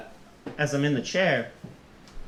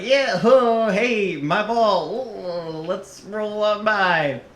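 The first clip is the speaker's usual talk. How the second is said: lightly hammed-up carefree act; drawn-out, slightly higher put-on voice; playing along; 5 semitones higher, much slower than usual. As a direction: play along with stretched-out, sing-song words. Acting playing along in the wheelchair.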